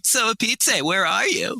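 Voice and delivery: mocking echo. Trapped woman calling back as an echo, mocking. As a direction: throw the call back mockingly. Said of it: "she just calls back mockingly".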